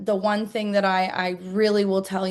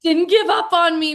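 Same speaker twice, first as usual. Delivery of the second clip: high, teary thank-you, half-imitated; retold crying; 9 semitones higher than usual. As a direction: replay the tearful message, voice lifting high. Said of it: "like, crying".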